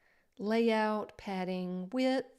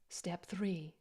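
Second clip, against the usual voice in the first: whispering; 4 semitones lower, 6 dB quieter than usual. Pretend. Whispered step cue.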